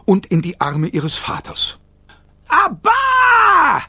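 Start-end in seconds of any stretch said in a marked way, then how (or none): 2.5-3.8 put-on very high, loud baby cry; baby shouting. A narrator's reading. Kayla flying into her father's arms, very high baby shout. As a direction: call out like a delighted baby.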